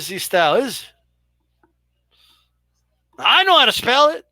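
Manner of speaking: usual speaking voice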